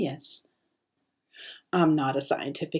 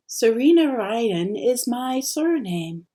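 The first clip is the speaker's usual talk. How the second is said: plain reading voice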